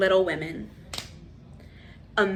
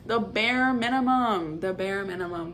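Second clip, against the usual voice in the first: ordinary delivery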